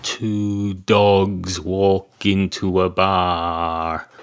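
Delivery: very slow, deliberate storytelling; drawn-out joke setup. Acted. Comedian setting up a joke about two dogs, drawn out slowly. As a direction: stretch out the words to set up a joke.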